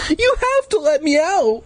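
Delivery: high panicked scream. A narrator's reading. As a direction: scream to be let out. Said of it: "I screamed".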